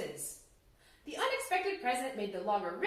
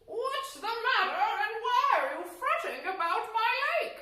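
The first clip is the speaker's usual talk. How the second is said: put-on demanding old man; 11 semitones higher, 5 dB louder than usual. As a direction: boom it out as a majestic old man. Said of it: "the majestic old man demanded".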